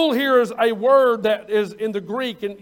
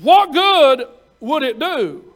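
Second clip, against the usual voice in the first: preacher's challenge; 3 semitones higher, 9 dB louder, slower than usual. Real, not acted.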